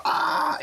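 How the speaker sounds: high breathy mock scream; overreacting scream. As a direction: yelp like something's chasing you. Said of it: "overreacting fucking, uh, YouTuber".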